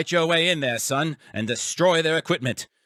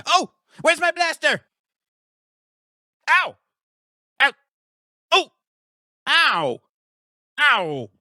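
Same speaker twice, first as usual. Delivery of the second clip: short loud ow cries of pain; pained yelps; 11 semitones higher, 4 dB louder than usual. Acted. Scientist getting hit during the fight, pained yelps. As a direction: yelp at each blow.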